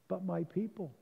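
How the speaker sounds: a broken friend begging quietly; soft plea. Acted. Eddie pleading softly with the president for his people.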